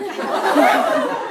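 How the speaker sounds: a big real burst of laughter; loud laughing burst. Real, not acted. Laughing at a baffling Armenian question.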